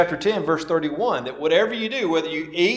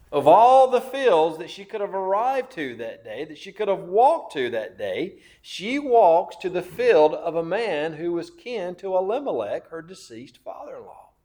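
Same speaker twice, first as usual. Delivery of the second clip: normal talking voice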